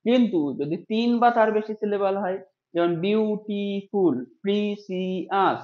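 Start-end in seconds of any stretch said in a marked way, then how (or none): 2.7-5.6 বিউটিফুল and প্রিসিয়াস drawn out very slowly, each syllable separate. Shown as a demonstration. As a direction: spell out the beats of বিউটিফুল and প্রিসিয়াস for the class. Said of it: "ওয়ার্ডগুলো দেখো".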